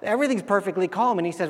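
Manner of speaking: normal talking voice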